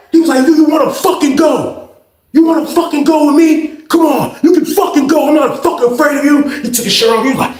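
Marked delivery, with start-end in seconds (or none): 0.5-6.5 squaring up; mock fight challenge.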